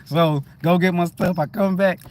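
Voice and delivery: ordinary talk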